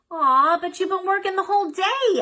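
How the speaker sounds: put-on high, loud childish whine; whiny complaint. A narrator's reading. Girl whines to her busy dad, high and pleading. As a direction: whine it like a let-down kid. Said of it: "Ellie complained".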